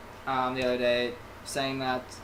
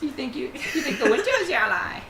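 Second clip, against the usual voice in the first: high-pitched voice; 8 semitones higher than usual. Pretend. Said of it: "Just have a super high-pitched".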